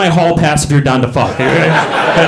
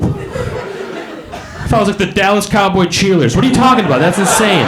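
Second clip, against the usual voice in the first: raised-pitch disbelief, mid-argument; incredulous protest; 6 semitones higher than usual. Retold or imitated. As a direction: retort as if the idea is absurd. Acts out argument with wife.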